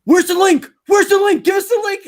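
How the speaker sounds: very high, loud mock pleading; high-pitched imitation of demanding viewers; clamouring fans. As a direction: pester in a squeaky, loud mimicking voice. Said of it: "so many people were like".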